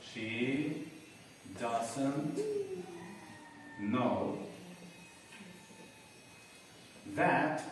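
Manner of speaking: dictation pace, long gaps between words; one word at a time, as if writing it. Shown as a demonstration. Teacher writing it for those who missed it, word by word. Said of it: "lo voy a escribir".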